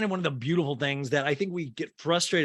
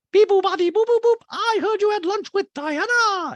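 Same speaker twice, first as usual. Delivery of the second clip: piercingly high, loud caricature with beeping; Dorit impersonation; 9 dB louder than usual. Retold or imitated. Host mimics housewife prying about lunch, very high loud voice. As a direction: babble robotic beeps way up high. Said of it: "And Dorit goes".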